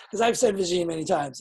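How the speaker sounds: ordinary delivery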